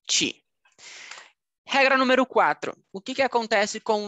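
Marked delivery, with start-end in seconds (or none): 0.1-0.4 "ti" said "chee"; T softened to CH. Shown as a demonstration. Teacher sums up the rule, "ti" as "chee". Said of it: "só mudaria o T I".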